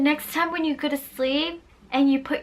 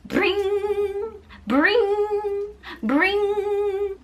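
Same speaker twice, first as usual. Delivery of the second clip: high sing-song bring bring like a ringing phone; mock phone ringing, pitched up; phone ring imitation; 6 semitones higher, much slower than usual. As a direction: ring it out like an old telephone.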